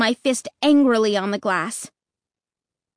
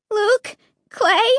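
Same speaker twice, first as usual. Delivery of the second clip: trembling voice; 11 semitones higher, 4 dB louder than usual. Acted. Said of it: "in a trembling voice".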